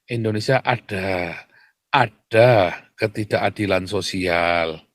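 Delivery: low, slow, careful evasion; mock-diplomatic, measured phrasing; mock euphemism. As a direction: imitate cautious official wording, drawn out. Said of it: "Ngomongnya begitu kan?".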